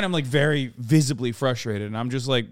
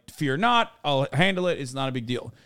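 usual speaking voice

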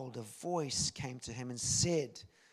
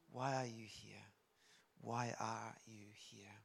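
soft, low, repeated question; hushed questioning with pauses; still small voice; 3 semitones lower, 8 dB quieter than usual. Acted. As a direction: put it gently to each listener, dropping away on the names.

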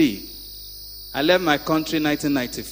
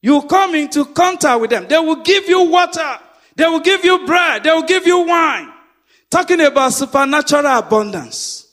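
higher, loud preaching cadence; 10 semitones higher, 7 dB louder than usual. Acted. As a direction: preach it out to the congregation. Preacher at full force.

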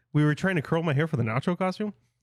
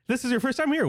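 usual speaking voice

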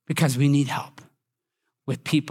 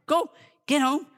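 higher-pitched retold shooing; mild command to a pesky dog; 9 semitones higher than usual. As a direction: tell the little dog off, not yet yelling. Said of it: "nips at her".

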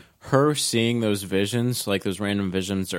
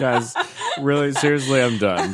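laughing mock quit; 3 semitones higher than usual. Joining the joke, mock quitting.

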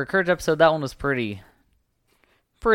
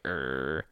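growl; 8 semitones lower, 8 dB quieter than usual. Retold or imitated. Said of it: "growls, like".

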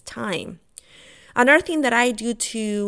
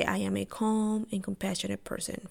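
the mantra again, much softer; softer repeat; 8 dB quieter than usual. Shown as a demonstration. Repeating her morning mantra to herself, much softer. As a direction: let it sink in, murmured to herself. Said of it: "I say".

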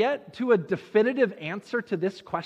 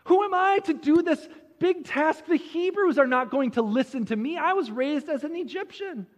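high, pleading run of objections; self-doubting protest; 6 semitones higher than usual. Acted. Moses objecting to God's big task, self-doubting protest. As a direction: pile up excuses in a higher voice. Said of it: "Moses then responds to God by asking".

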